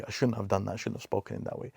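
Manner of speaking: quiet guilty self-reproach, fading to a whisper; guilty murmur. Retold or imitated. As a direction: hush it like a private confession. Guilty person's inner voice.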